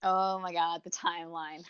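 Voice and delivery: ordinary talk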